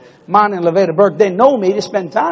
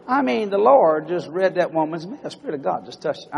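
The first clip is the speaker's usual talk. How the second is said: ordinary talk, nothing marked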